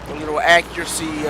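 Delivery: ordinary talk, nothing marked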